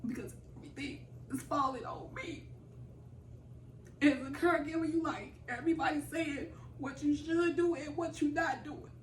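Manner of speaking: holding back tears, pushing on; emotional. Real, not acted. Caregiver telling the interviewer her burden, near tears. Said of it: "it's just be having my moments".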